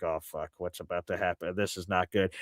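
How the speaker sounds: low, hushed dread; retold uneasy aside, soft and low; worried aside. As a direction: say it under your breath. Acted worried aside to himself.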